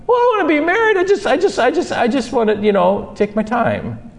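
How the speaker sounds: high protest tumbling into hesitation; stammering, hammed-up backpedalling; defensive fiancé. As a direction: act out a squirming excuse, starting high. Acted reluctant fiancé making excuses.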